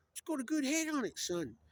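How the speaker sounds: high, loud put-on father voice; father's verdict. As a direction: voice the movie dad, high then dropping on the last word.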